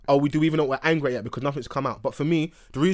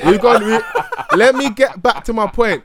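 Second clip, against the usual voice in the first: laughing; 5 semitones higher, 6 dB louder, slower than usual. Real, not acted. Still laughing, trying to continue.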